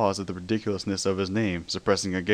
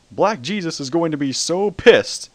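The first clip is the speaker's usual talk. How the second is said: loud, slow, pitchman bellow; Billy Mays voice; 7 semitones higher, 8 dB louder than usual. A narrator's reading. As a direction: boom it like a TV pitchman. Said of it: "in his trademark voice".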